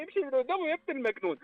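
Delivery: mock-tender mother. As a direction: lightly play a mother shooing her son. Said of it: "وقالت بصوت حنون".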